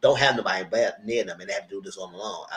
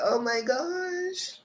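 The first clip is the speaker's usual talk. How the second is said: very high, drawn-out coo, softly; high-pitched gushing; 6 dB quieter, much slower than usual. Retold or imitated. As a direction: squeal it softly and slowly. Own retold reaction to family support.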